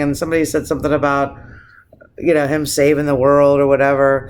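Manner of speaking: ordinary delivery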